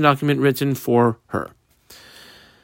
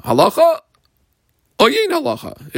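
sing-song Talmud question; 12 semitones higher than usual. Acted. Teacher voices the Talmudic question.

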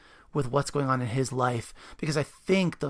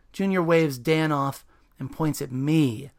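lively narration of a novel; story reading; 4 dB louder than usual. A narrator's reading. Host reading the book aloud after the author. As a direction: read the passage with energy.

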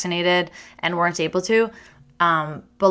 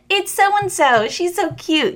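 high doting coo; doting counselors; 11 semitones higher, slower than usual. Retold or imitated. Counselors fawning over the girl who cuts the line, high and cooing. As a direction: gush like a fond counselor.

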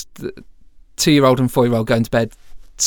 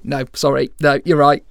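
normal talking voice

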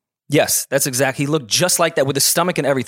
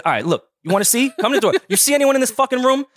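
heated; 10 semitones higher than usual. Retold or imitated. Confronting drunk guard at door.

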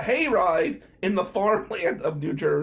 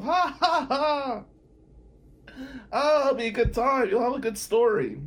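high cackling imitation of the moms laughing; mock mothers' laugh; 5 semitones higher than usual. Moms laughing at his date woes, mock high cackle.

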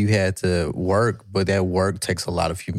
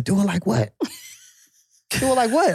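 high, laughing mock indignation; high squeaky do it like what; mock indignant. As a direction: bristle at being corrected, hammed up. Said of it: "And I said".

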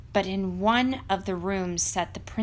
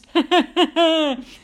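booming giant laugh; 8 semitones higher, 9 dB louder than usual. Acted. Giant scents blood.

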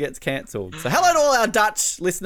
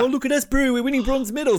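higher, lightly voiced bragging Kiwi; mock New Zealander; 6 semitones higher than usual. Co-host ribbing New Zealand over its bronzes, mocking Kiwi voice. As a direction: put on a hint of a boastful Kiwi.

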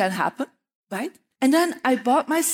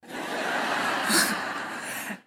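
breathy laugh; 4 dB quieter than usual. Real, not acted. Laughing at her own story.

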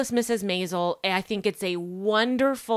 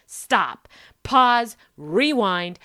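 excited interruption; 4 semitones higher, 6 dB louder, slower than usual. Retold or imitated. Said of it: "I was like".

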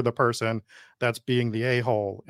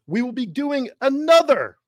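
excited; 10 semitones higher, 5 dB louder than usual. Acted. Hosting hype, sudden high loud.

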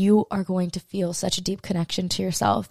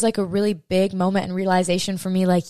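normal talking voice

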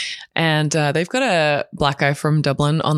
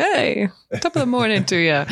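whispered mock Irish brogue; a half-hearted stab at an Irish accent, breathed out; badly faked Irish accent, whispered; 4 semitones higher than usual. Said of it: "I can't do an Irish accent".

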